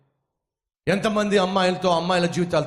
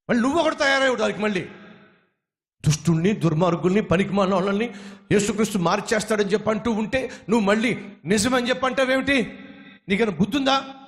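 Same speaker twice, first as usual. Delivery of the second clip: high, fast angry yelling; angry shouting; 5 semitones higher than usual. Acted. The thug shouts at the lame man for siding with the preacher. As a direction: hurl it fast and hot. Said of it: "గట్టి గట్టిగా అరుస్తూ".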